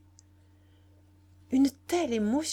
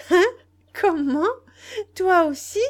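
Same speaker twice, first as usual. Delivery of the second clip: high, loud bursts of disbelief; astonished cry; 10 semitones higher, 10 dB louder than usual. A narrator's reading. Nana reacts to the boy's proposal, crying out astonished. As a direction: cry out each question in shock. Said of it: "cria-t-elle".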